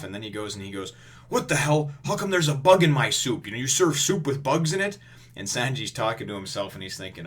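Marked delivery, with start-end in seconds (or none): 1.3-5.0 high, loud outraged diner; mock anger. Iron Fist complaining about his soup, mock outraged. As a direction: act out a pissed-off complaint. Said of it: "gets all pissed off".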